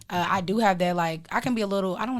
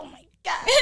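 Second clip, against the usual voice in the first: exasperated laugh; 13 semitones higher, 6 dB louder than usual. Retold or imitated. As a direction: groan it through a laugh. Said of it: "I'm like".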